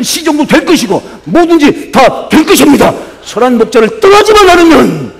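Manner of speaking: loud, high, fervent sermon climax; rousing preacher proclaiming victory with the shepherd; full-force preaching. Acted. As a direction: build it up like a revival preacher. Preaching climax, rising exhortation.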